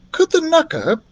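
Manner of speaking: high, timid question; a small high voice, cut off; voiced girl Beatrice. Beatrice asking the doctor, high and hesitant.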